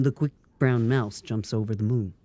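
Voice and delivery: deep imitation of a man's voice; Dartigan. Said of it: "That's getting pretty close".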